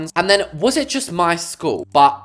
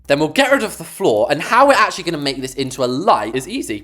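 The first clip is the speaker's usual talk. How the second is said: ordinary delivery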